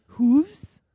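"hooves" with a long oo; "hooves" with the oo stretched, as the British narrator says it. Retold or imitated. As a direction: say "hooves" drawn out to rhyme with "moves". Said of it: "if Tim Gerard Reynolds is saying this right".

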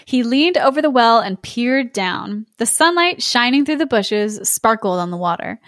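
ordinary delivery, nothing marked

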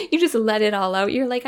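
ordinary talk, nothing marked

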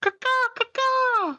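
high slow crow caws; shrill screeching bird woman. Acted. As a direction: screech each caw like a bird. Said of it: "the count making bird noises".

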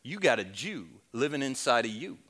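low, hammed-up Southwestern drawl; deliberately poor Southwest accent, low and soft; very bad Southwestern drawl. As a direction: badly fake a Southwestern drawl for the punchline. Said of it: "in a very bad Southwestern drawl".

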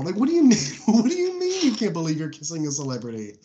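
high, fast, baffled outburst half-laughing; incredulous disbelief. Retold or imitated. Podcaster mocking a romance movie line, incredulous and baffled. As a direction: sputter in mock outrage at the absurd line.

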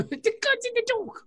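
high, lightly put-on British voice; Luther imitation. Quoting Luther to friends, light British accent. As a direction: quote the detective line in a Brit voice. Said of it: "I wish he talked like that".

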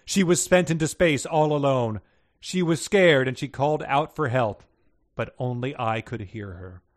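projected solemn lament, falling away; profound sadness. A narrator's reading. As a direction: start loud, then sink in mild sorrow. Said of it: "now striking tones of profound sadness".

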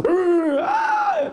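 loud, high growl rising into a shriek; growl, then scream like a goblin; mock goblin screech; nonstop goblin screaming. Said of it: "just nonstop".

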